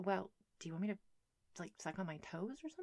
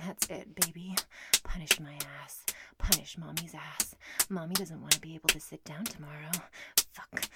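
usual speaking voice

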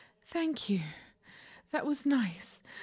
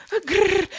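a much louder, sharp cat growl; 2 semitones higher, 10 dB louder than usual. Acted. Catgirl stuck in a window, growling at her partner.

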